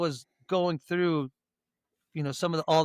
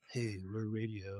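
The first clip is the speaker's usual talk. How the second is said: lower, softer radio announcer; 9 semitones lower, 15 dB quieter, slower than usual. Retold or imitated. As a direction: drop into a deep DJ voice. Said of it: "you guys have that, like".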